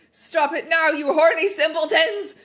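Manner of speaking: loud, high, scolding shout; furious shout. Acted. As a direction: bellow at the students to stop. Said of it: "shouted Professor McGoggle".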